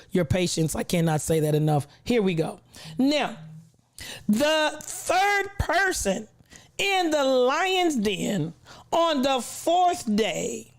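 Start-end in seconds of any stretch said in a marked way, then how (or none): 3.0-10.7 slow, high ceremonial build-up; grand announcer. Acted. Streamer announcing the third person in the Lion's Den, grand announcer. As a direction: draw out each phrase like a ring announcer. Announces roll-call arrival.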